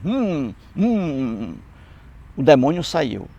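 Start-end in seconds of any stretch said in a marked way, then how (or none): 0.0-1.5 short strained hums through closed lips; mocking mute mumble; mute man's humming. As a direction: play the mute trying to speak. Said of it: "Aí ele ficou mudo".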